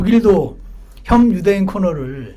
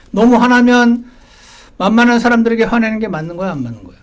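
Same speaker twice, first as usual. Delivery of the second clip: pressing father; 4 semitones higher, 6 dB louder than usual. Retold or imitated. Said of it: "너는 왜 그래?".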